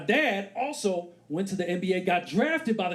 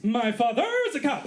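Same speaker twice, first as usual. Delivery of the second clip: slow, deliberate rehearsal read; line reading; 3 semitones higher, slower than usual. Pretend. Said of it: "I'm doing line readings".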